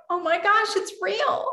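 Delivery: high, breathless disbelief; can't believe it's finally happening; overwhelmed joy. Retold or imitated. Mother recalling the judge's words, overjoyed disbelief. Overwhelmed relief at the adoption hearing.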